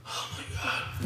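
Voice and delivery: toneless whispered non-reaction; underwhelmed whisper. Retold or imitated. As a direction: whisper it with barely any reaction. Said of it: "doesn't react at all".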